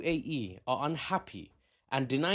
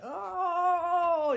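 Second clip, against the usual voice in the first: meme gasp. Retold or imitated. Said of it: "you know that meme of that guy is like".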